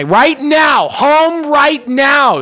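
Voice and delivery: high mock bellow, slow, stretched. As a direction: demand it at the top of her voice. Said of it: "she bellowed".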